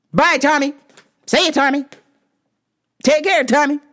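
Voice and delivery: high, chirpy mock goodbye; see him off with exaggerated cheer; pointed farewell. Clerk sending off complaining customer, pointed farewell.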